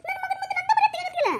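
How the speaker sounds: high complaining son's voice; whiny protest. Acted. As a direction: whine at mother about lost face. Son complains to mother, embarrassed. (ಕೊರಗುವ ಮಗನ ದೂರು)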